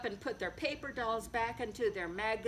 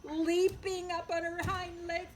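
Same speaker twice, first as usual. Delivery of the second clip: usual speaking voice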